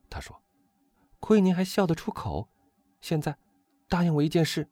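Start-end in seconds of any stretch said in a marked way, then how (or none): 1.2-4.7 high, insistent woman's voice; reproachful countess. A narrator's reading. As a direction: scold him in the countess's high voice.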